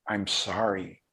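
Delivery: soft, subdued plea kept to oneself; silent inner apology. Retold or imitated. Soldier inwardly begging each Vietnamese person's forgiveness, quiet plea. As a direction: retell a private apology, hushed. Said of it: "I would say this silently".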